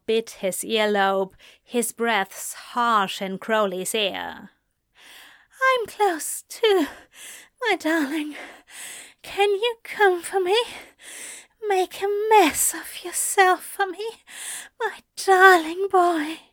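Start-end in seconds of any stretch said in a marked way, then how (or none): 5.7-16.4 high, eager voice of a lover urging his partner; high, urgent lover's plea; Aziraphale, pleading with desire. A narrator's reading. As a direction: coax him higher and higher, lovingly. Aziraphale's voice in the story.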